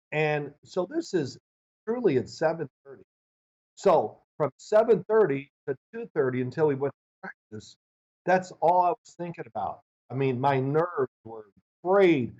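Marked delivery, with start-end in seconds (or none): none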